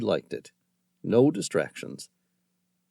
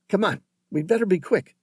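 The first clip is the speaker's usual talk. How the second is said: urgent; 9 semitones higher, 3 dB louder, faster than usual. Acted. John urges hurry.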